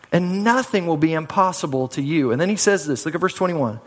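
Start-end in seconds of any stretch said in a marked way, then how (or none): none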